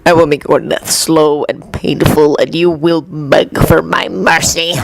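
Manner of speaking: low menacing snarl of a villain; snarled threat. A narrator's reading. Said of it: "snarled the man".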